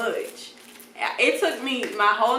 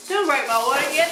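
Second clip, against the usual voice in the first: gasp, then pleading; 4 semitones higher than usual. Retold or imitated. Doctor about to break water.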